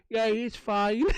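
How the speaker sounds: lightly voiced officer satisfied; mock officer. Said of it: "officer's looking through the window going".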